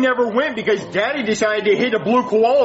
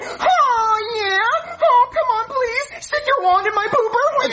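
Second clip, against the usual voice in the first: put-on high, whiny ghost-girl wail; much higher, crying put-on ghost voice; 13 semitones higher, slower than usual.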